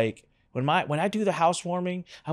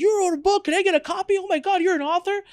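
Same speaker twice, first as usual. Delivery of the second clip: much higher, gushing "You wrote a book?"; gushing family. Retold or imitated. Whole family crowding round the book, high gushing excitement. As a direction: fawn over him, starstruck. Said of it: "family's like".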